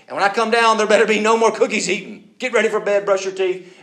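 loud, high bedtime orders; stern parent. Acted. Parent orders children to bed, stern and loud.

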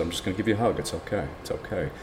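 softly soothing an ostrich before a hug; soothing hush. Retold or imitated. Man quietly soothes an ostrich he is about to hug. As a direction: hush it, it's okay, as if to a spooked animal.

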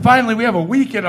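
a light, high mock of an excited girlfriend; mock girlfriend excitement. Girlfriend voiced, happy weekend.

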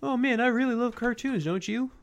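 high, cheery cartoon frog voice; chirpy mock-innocent Kermit; Kermit impression. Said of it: "He's like".

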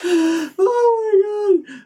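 high, slow, crying with laughter; laughing hard. Real, not acted. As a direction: gasp it out while laughing.

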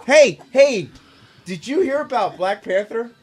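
high, exaggerated mock enthusiasm; mock eager fan. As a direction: ham up the excited fan.

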